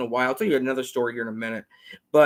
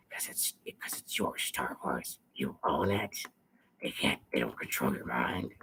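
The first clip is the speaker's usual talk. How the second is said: much lower, whispered and slow; conspiratorial whisper; 12 dB quieter than usual. Acted. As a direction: whisper it like a secret. Character voice turns conspiratorial.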